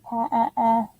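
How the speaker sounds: put-on quiet phonics ah for a child; ah sound. Shown as a demonstration. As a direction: isolate the ah of Pa. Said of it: "What letter makes that ah sound".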